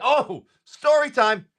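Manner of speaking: high, drawn-out announcement; sudden remembering, stretched out high and slow; excited announcement. Real, not acted. Streamer suddenly remembers a tale for viewers, excited announcement.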